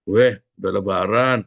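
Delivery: mock neighbour jibe. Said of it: "bilang begitu".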